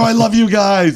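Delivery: high, loud declaration of love to a booth crew; high, loud fan gush; overexcited gush. Retold or imitated. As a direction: gush like a crazed superfan. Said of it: "I had 'em worried".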